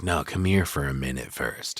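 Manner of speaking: low, quiet menacing father voice; imitating Jack. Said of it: "And he's like".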